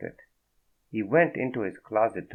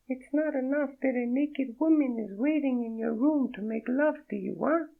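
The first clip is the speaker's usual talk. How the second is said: high, breathy-free French woman's voice, much higher; a startled French woman, voiced high; French accent. Naked woman startled by Fredo, in a high French accent. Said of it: "The accent was real".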